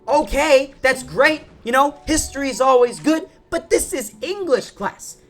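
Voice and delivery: high, loud, drawn-out appeal; exasperated plea. Retold or imitated. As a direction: plead with a teacher in mock desperation. Retold plea to the teacher.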